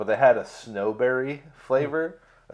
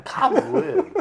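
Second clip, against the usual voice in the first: laughing; 14 semitones higher than usual. Pretend. Third take, laughing.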